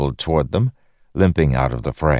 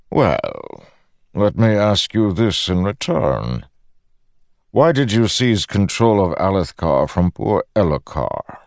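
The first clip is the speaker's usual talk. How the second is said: higher-pitched, lofty questioning; Odium, probing god; 6 semitones higher than usual. A narrator's reading. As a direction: challenge him from above. Voices Odium questioning Dalinar.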